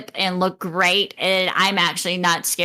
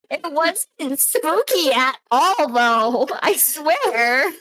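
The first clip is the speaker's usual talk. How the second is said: mock innocence; 7 semitones higher, much slower than usual.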